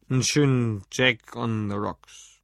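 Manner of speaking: put-on slow, slurred drunken drawl; drunken slurring. A narrator's reading. Drunk customer orders whisky from the barman, slurring slowly. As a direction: drag the words out like a drunk. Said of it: "lallte Lou".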